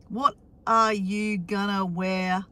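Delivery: normal talking voice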